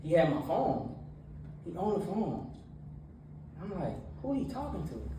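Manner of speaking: energetic church talk; preaching. Acted. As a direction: preach it to the young crowd with energy. Youth sermon with retold story.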